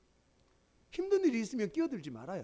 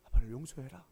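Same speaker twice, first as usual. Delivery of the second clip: a hushed 아빠를 용서해라; 6 semitones lower than usual. Retold or imitated. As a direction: ask gently, held back. Father begs sons' forgiveness again.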